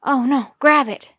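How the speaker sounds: loud, urgent order; alarmed. Acted. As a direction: call out in sudden alarm. Johnny reacts to the radio alarm.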